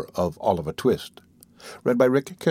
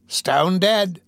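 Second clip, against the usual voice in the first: higher-pitched, drawn-out question; old woman inquiring; 8 semitones higher than usual. Acted. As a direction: ask it in a high old woman's voice. Old woman asks if she died.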